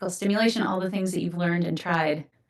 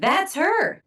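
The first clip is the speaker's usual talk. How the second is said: excited recognition; 10 semitones higher than usual. Retold or imitated. Spotting the speaker at buffet.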